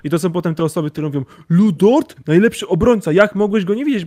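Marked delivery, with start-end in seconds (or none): 1.5-4.1 high, loud fan outrage; indignant superfan. Retold or imitated. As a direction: play the offended superfan. Imitating a one-team fan.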